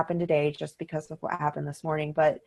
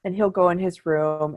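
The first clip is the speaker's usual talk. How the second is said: ordinary delivery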